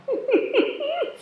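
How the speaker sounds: a high, bright burst of real laughter. Real, not acted. Laughs at own punchline.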